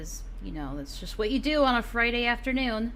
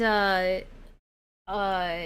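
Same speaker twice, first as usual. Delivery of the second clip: usual speaking voice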